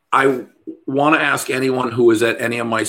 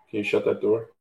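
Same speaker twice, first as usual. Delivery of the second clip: ordinary delivery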